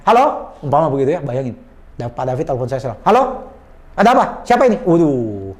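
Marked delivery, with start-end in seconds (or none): 0.0-0.5 curt phone greeting. Shown as a demonstration. Trainer mimics a bad phone answer, curt and off-putting. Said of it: "kalau orang ngomongnya itu kayak gimana". 3.0-4.8 rude phone manner. Shown as a demonstration. Trainer plays a salesman rudely answering a phone call, brusque. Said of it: "Itu langsung, ... langsung turn off orang".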